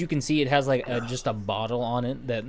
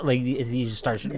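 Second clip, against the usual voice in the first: ordinary talk, nothing marked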